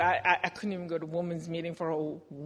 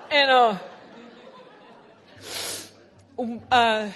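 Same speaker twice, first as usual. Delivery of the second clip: laughing; 3 semitones higher, 9 dB louder, slower than usual. Real, not acted. Laughing after the joke.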